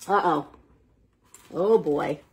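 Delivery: ordinary delivery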